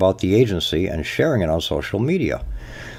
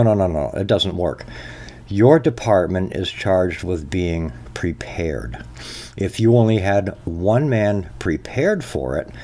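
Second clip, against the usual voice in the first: ordinary delivery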